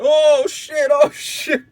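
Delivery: sudden panicked realization; startled. Retold or imitated. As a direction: yelp as the trip kicks back in. Mushroom trip suddenly hits again.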